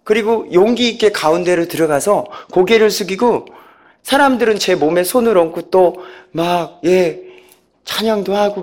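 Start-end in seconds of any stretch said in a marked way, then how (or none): none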